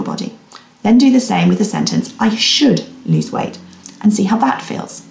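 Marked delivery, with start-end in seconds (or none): 2.2-3.6 "should" drawn out slowly; stress on should. Shown as a demonstration. Coach has listeners test the sentence, slow stress on "should". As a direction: linger on the word "should". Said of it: "emphasis on the word should".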